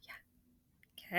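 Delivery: a breathy unvoiced yeah; whispered yeah. Real, not acted. Teacher answering the class softly, whispered.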